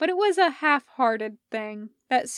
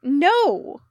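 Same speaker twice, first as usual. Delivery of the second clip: loud no; 5 semitones higher, 8 dB louder than usual. Acted. Hardison corrects Elliot.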